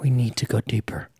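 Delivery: low, hushed mock-serious whisper; ominous whispering, played for laughs; dramatic whisper. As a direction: whisper it like a film line. Jokey dramatic line about going further.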